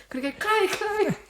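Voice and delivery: high whiny calling voice; mock child call. She pretends to summon Chloe on the plane, high whine. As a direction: call out like a needy kid. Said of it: "when we go".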